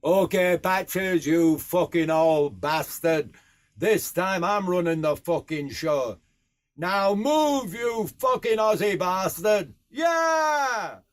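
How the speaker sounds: defiant broad Yorkshire, building to a high yell; Yorkshire lad swearing at his horse, rising to a shout; broad Yorkshire accent. A narrator's reading. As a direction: take charge of the horse in thick Yorkshire. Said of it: "in my broad Yorkshire accent".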